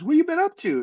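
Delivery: high-pitched imitated small talk; chirpy retold greeting; retold friendly question.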